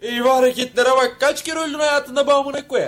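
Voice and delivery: high, loud scolding; exasperated outburst. Acted. Man scolding someone for dramatic moves, exasperated outburst. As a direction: complain loudly in disbelief.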